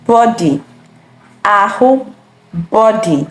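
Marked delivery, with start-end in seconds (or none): none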